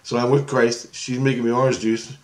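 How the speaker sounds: ordinary talk, nothing marked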